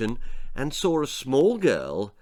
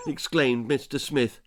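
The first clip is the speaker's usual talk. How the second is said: ordinary delivery, nothing marked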